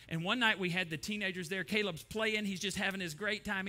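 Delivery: ordinary talk, nothing marked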